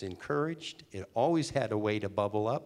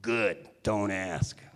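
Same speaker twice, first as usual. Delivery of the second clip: Tony Owen voice; 2 semitones lower, 3 dB louder, much slower than usual. Retold or imitated. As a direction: drawl it out like a Chicago boss closing the subject. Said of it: "he talked like this".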